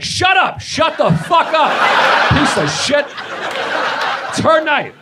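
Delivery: hammed-up scolding of a useless husband; mocking angry nurses. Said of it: "they're like".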